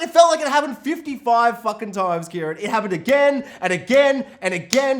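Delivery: loud, high incredulous rant; exasperated exaggeration. Real, not acted. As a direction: vent your disbelief at the repetition. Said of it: "exasperated rant".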